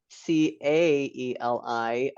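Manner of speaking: very slow letter-by-letter spelling. Shown as a demonstration. Host spelling her own tricky name, slowly letter by letter. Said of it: "is not how it would be pronounced".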